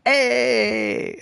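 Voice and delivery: high, loud sing-song hey; a group of weirdos belting hey; mock weirdos hey. Weirdos greet the salesman, high sing-song hey. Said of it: "weirdos sitting there going".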